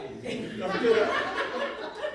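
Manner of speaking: high, loud helpless laugh; real laughter bursting out. Real, not acted. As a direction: crack up at the punchline. Story punchline makes her crack up.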